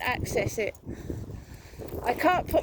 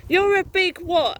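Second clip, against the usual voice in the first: louder and higher, demanding an answer; angry challenge; 6 semitones higher, 5 dB louder than usual. Real, not acted. Woman challenging her online harasser, loud and angry. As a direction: throw the question back at him hard.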